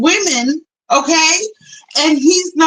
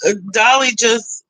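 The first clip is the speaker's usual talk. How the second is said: ordinary talk, nothing marked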